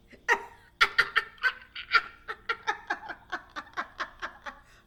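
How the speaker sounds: loud, high, long cackling laugh; laughing hard. Real, not acted. Reacting to Scotland's reading, real high loud laughter. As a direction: crack up and keep going.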